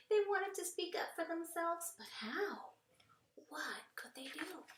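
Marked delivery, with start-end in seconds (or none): 2.0-4.7 soft whispered wonder; hushed storytelling. A narrator's reading. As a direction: whisper it like a secret. Storytelling suspense question.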